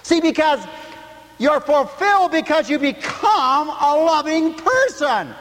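high, loud, fervent preaching; full-force preaching. Acted. Preacher to his congregation, full-force preaching.

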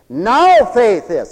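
slow, loud punch on "Now"; stress on NOW. Shown as a demonstration. Preacher repeating scripture to drive it home, stress on NOW. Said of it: "so you gotta say".

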